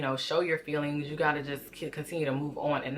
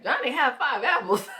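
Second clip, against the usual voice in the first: higher, blankly parroting the lesson back; clueless parroting; 10 semitones higher, 4 dB louder than usual. Retold or imitated. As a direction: repeat it like a confused pupil. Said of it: "And I'm still like".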